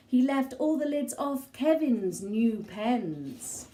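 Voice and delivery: ordinary delivery, nothing marked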